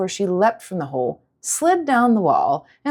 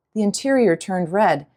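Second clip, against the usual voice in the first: usual speaking voice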